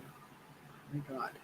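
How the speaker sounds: slow, voiceless whisper. Real, not acted. Grandma gazing at a photo of her granddaughter, slow whisper. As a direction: draw it out in a hush.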